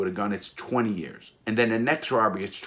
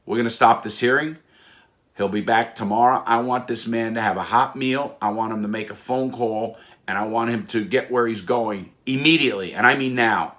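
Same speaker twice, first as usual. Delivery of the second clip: acting a stern judge, louder and emphatic; commanding judge; 2 semitones higher, 5 dB louder than usual. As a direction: lay down orders like a federal judge. Judge orders the marshals sharply.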